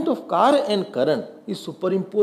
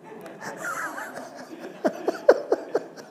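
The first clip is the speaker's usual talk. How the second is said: laughing; 5 dB quieter than usual. Real, not acted. Student laughs at own change.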